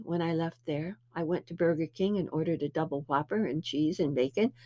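ordinary talk

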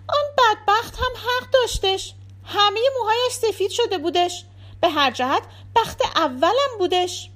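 high-pitched pleading character voice; Anis defending her husband. Acted. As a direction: plead his case in a high voice. (صدای زیرِ انیس با لحن دفاع)

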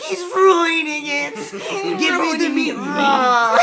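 high, slow, rasping creature whine; Smeagol impression. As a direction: whine it out like Gollum. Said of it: "He's like".

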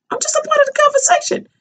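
high, fast squeak of innocence; a lightly mocking high innocent voice; squeaky innocent protest. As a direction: play it wide-eyed and squeaky. Retold own innocent protest.

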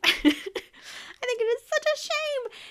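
squeaky high mock-indignant voice; high, theatrical pretend shock; mock outrage. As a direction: repeat it in a silly high voice. Mock repeat of own line.

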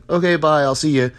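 high, loud, airy brush-off; breezy dismissive goodbye. Retold or imitated. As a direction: toss off a careless goodbye. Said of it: "and just like".

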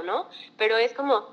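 ordinary talk

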